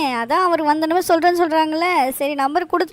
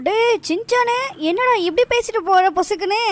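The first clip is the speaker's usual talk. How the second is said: higher-pitched, taken-aback call; startled protest; 4 semitones higher than usual. Acted. Friend calling after the boy who walked off rudely, startled protest. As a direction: call after him in disbelief.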